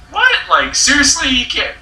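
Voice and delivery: high-pitched incredulous reaction; astonished protest, pitched high; retold disbelief. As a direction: push it up high, as if you can't believe it. Retells own reaction to airline story.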